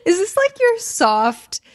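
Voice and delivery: talking through laughter; real giggle mid-question; laughing. Real, not acted. Laughs at partner's radio voice.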